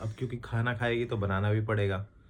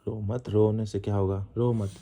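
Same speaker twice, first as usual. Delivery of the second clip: firm, louder pleading to stop crying; 7 dB louder than usual. Real, not acted. Husband urging his crying wife to stop, louder and firm. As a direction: urge her to stop, voice raised.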